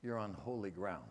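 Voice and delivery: low, soft, solemn voice of God; a hushed, deep command from above. Retold or imitated. God warning Moses at the burning bush, low and hushed. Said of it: "and the voice of God says".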